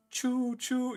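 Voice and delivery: normal talking voice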